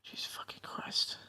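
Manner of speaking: soft breathy whisper, fed up; whispered exasperation. Real, not acted. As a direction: whisper it under your breath in disbelief. Exasperated GM after accent debate.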